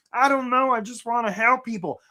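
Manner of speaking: high, goofy bumpkin voice; mock a clueless job seeker with no plan; dopey, helpless simpleton voice; Gomer. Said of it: "That's great, Gomer".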